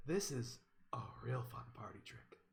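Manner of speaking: whispered, confiding showman's line; hushed confiding. Acted. Gwen showing Jay a toy, hushed and confiding. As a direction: let them in on a secret in a whisper.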